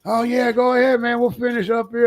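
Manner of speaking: put-on very high naive kid voice; high-pitched child impression; younger twins. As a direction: chirp it high like an obliging child. Twins reply, unaware of the trick.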